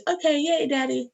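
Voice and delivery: put-on high, delighted little girl cheer; cheering daughter. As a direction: squeal a happy yay to daddy. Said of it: "daughter cheering in story".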